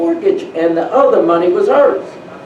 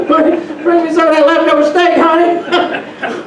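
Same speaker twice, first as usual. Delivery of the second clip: begging like a puppy; 7 semitones higher than usual. Retold or imitated. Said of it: "I said, "Aunt Mabel, bow wow".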